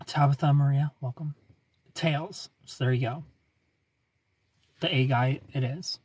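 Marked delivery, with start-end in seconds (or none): none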